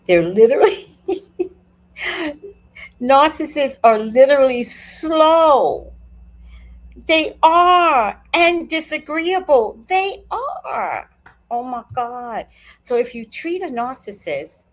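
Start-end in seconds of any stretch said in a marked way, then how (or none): 0.1-2.4 amused outburst. Real, not acted. Telling viewers what narcissists literally are, breaking into laughter. 3.0-11.1 loud, high, drawn-out insistence; emphatic verdict. Real, not acted. Telling viewers about narcissists, loudly emphatic and drawn out.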